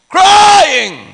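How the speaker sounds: loud, high drawn-out cry. Shown as a demonstration. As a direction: shout it like a wilderness preacher. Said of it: "Hindi talking".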